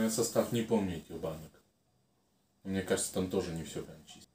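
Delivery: ordinary talk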